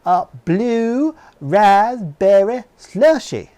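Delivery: put-on very slow, loud, high Southern-accent order; angry customer repeating his order, slow loud Southern accent; annoyed Southern accent. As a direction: spell it out slowly, fed up, in a Southern accent. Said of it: "The guy got mad at me".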